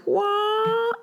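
drawn-out high rising-above sound effect; playful held high "waah"; high sung-out "wah". Retold or imitated. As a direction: imitate soaring up on a long high note. Mimics rising above like Jedi Master.

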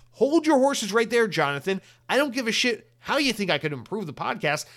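fake-angry scolding of a listener who wants to critique; mock outrage.

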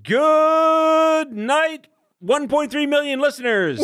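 high, long-drawn "good", big announcer call; showman's sign-off. Acted. Host signing off to the listeners, hammed-up drawn-out call. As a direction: holler it out, stretching the first word.

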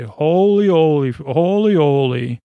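fan's loud amazed chant at the unmasking; excited holy. Pretend. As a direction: gush at the reveal. Seeing the actor unmasked.

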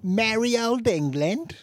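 high, hammed-up English accent; mock English accent. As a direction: put on a proudly awful English accent. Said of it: "how to do an English accent".

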